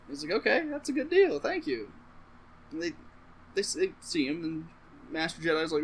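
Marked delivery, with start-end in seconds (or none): 0.3-1.9 squeaky high-pitched agreement; high mock voice. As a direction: squeak it like a cartoon. Jedi quoted high.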